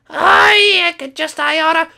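very high, slow, choked-up fury; angry Skittles. Acted. Skittles furious at Sing Song, very high and sputtering.

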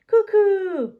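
high, loud two-note cuckoo; bright storybook bird call, pitched well up; cuckoo clock call. Acted.

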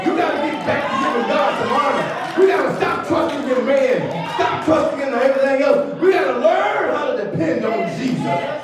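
impassioned pulpit exhortation; full-force preaching. Real, not acted. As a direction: rouse the congregation with driving repetition.

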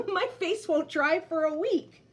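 high, loud, giggly bear; laughing bear. Acted. Tangerine bear laughing at Bird, giggling. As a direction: laugh till it hurts. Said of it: "would cry as tears ran".

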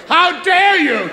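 very high, slow, grand stage outrage; over-the-top theatrical fury; Victorian melodrama. Retold or imitated. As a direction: play it like a Victorian tragedian. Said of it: "Straight from Victorian theatre".

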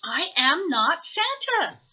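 put-on a measured childlike denial; lightly voiced Baby Owl. A narrator's reading. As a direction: gently insist in a small owl voice. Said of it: "said Baby Owl".

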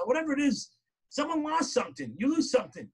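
ordinary talk

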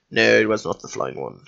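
deadpan self-answer. Shown as a demonstration. Player answering his own question, low deadpan. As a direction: drop low and quietly settle the question.

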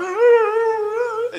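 high, drawn-out mock wail; mock funeral wail. Children playing at a mock funeral, high mock wail. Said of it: "pretending they were having a mock funeral".

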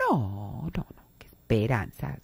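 denial. Pretend. Lonely person denying loneliness, hushed brush-off. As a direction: wave the question away too quickly. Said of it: "la niego".

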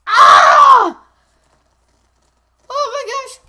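high, loud panicked yell; panicked shout. Real, not acted. Gamer under attack shouting in panic. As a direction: scream as you get hit, then gasp it out.

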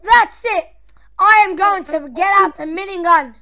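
theatrical villain indignation; play-acted outrage. Boss fuming over his stomped friend, play-acted outrage.

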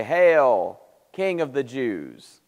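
mock homage, drawn out; slow mocking salute; spiteful mockery. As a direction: hail him with exaggerated, scornful reverence. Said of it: "It's a spiteful, hateful thing".

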